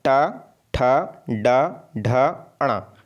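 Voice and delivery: retroflex ट, ठ, ड, ढ, अणा, each on its own; tongue curled up for ट, ठ, ड, ढ, with pauses; spaced-out drill of ट, ठ, ड, ढ, अणा; pronunciation demo. Shown as a demonstration. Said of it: "मूर्धन्य के अंतर्गत क्या आता है".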